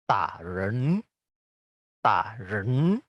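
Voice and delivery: ta held low, flowing into rising ren; ta without a rise, joined to ren going up; half third tone. Shown as a demonstration. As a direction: keep ta down, then lift ren. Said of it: "Waktu dirangkaikan dua kata ini menjadi".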